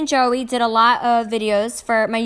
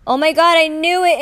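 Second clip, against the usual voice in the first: louder, higher sudden recall; excited remembering; 5 semitones higher, 5 dB louder than usual. Real, not acted. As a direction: burst out as it comes back.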